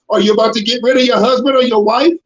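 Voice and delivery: normal talking voice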